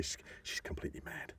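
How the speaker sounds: unvoiced, confiding hush; whispered gossip. A guest passes on Malkovich's secret, whispered.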